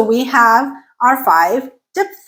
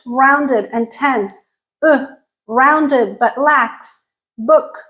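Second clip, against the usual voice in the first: ordinary delivery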